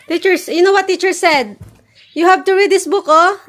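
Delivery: emphatic parent pleading. Real, not acted. Mother urging child, raised voice.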